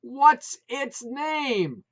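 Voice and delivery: loud, slow, high; top of his lungs. A narrator's reading. Putz bellowing the name question, slow loud. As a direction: drag out each word loudly. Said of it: "at the top of his lungs".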